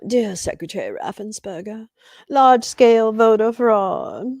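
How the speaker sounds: mock letter-writer voice. Streamer restarting Trump's letter for the heckler, mock voice. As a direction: put on a pompous letter-reading voice. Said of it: "shit that your boy wrote".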